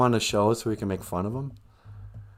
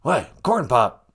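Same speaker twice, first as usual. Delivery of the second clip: ordinary delivery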